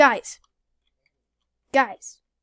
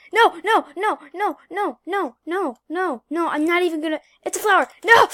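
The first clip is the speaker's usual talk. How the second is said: panicked shout; 5 semitones higher, 7 dB louder than usual. Real, not acted. Panicking as a camper appears.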